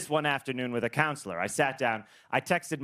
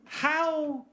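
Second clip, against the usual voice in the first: high, drawn-out wonder; mock bewildered inquiry; puzzled slow question; 4 semitones higher, slower than usual. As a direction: ask it slowly in disbelief. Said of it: "I go".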